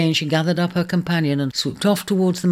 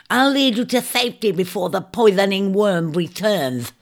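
crafty shrike; 7 semitones higher than usual. Acted. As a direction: lure him along in a high bird voice. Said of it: "a crafty plan forming in its mind".

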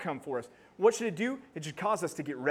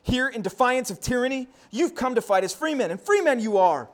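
put-on loud, high William Wallace rally cry, no accent; rally the army to fight for freedom; rousing battle speech; 7 semitones higher, 7 dB louder than usual. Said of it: "when it says".

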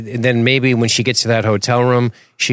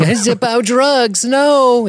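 high, loud, slow mock panic as he flees; panicked outburst; 5 dB louder, much slower than usual. Clueless man fleeing a laser show, hammed-up panicked yell. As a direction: shriek it out like a spooked nerd fleeing. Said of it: "He runs out of the room saying".

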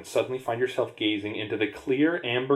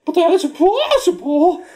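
very high, loud, slow disbelief; squeaky high voice; 8 dB louder than usual. Acted. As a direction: squeak out the protest. Player line very high.

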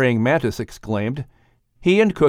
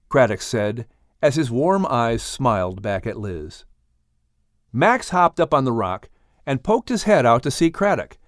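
ordinary delivery

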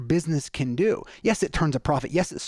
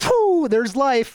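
slow, drawn-out, much higher and louder sigh of dread; weary phew; 9 semitones higher, 6 dB louder, much slower than usual. Pretend. Listener back from a podcast facing life, drawn-out high loud phew. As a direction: heave it out like life just hit you.

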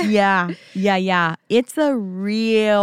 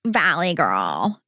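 put-on Valley girl accent; 4 semitones lower, much slower than usual. Shown as a demonstration. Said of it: "That's not how you sound".

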